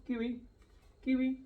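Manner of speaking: high, rising Kiwi; high call to bird. Shown as a demonstration. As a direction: sing the name up high. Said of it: "you say kiwi".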